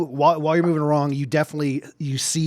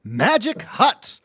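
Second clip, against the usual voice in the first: announcer voice; 4 semitones higher than usual. Acted. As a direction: call out the next stop like a conductor. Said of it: "hearing the announcement".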